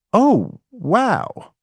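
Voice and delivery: high, amazed little oh wow; surprised oh wow. Retold or imitated. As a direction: sound truly struck by the idea. Retold moment of realization.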